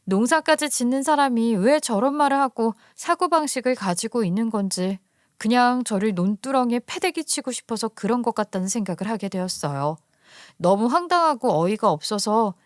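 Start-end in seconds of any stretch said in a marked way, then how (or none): none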